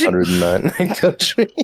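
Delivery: high, slow, through laughter; laughing. Real, not acted. Repeats joke while laughing.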